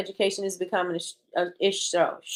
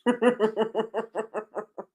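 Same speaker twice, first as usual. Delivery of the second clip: a knowing held-back laugh; guilty little laugh; sly giggle; 4 dB quieter than usual. Real, not acted. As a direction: giggle at the secret. Giggle after holding back.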